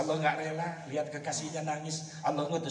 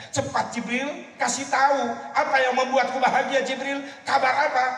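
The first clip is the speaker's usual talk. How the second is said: eager plea; 12 semitones higher, 5 dB louder than usual. Acted. Prophet eagerly asks for news.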